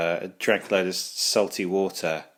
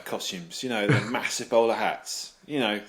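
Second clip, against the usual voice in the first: ordinary delivery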